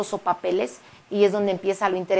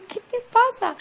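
high, startled concern from a classmate; worried classmate. Retold or imitated. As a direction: ask what's wrong, pitched up high. Classmates react to her crying. (compañero preocupado, voz muy aguda)

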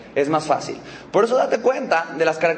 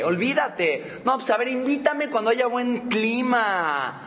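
high, whining mock-outraged rant; hammed-up indignant grumbling, pitched high; acted angry excuses; 4 semitones higher, slower than usual. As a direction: complain with stretched line endings, blaming everything. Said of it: "los que están enojados no bromean".

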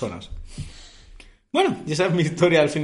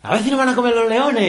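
put-on higher, louder Andalusian-accented Timón jab; 10 semitones higher, 6 dB louder than usual. Friend mocks the Andalusian Timón from the musical, Andalusian accent. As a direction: mimic Timón's Andalusian joke loudly. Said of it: "Timón es andaluz".

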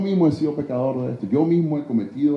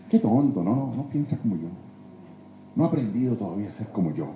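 soft, muttered mock contempt; smug inner thought; 7 semitones lower, 6 dB quieter than usual. Preacher voicing a proud discipler's thoughts, soft mocking scoff.

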